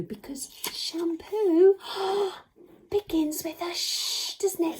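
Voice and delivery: high, slow wonder with a gasp; excited realisation. Pretend. Teacher revealing the sound clue, gasping excited realisation. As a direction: act out a big discovery, stretching "shampoo".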